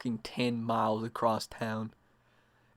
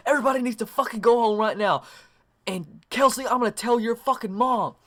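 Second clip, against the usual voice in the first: high, raised scolding, imitating a mother; acted irate mom rant, pitched up; angry mom; 9 semitones higher than usual. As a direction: order them out and threaten to tell. Angry mom sends kids home.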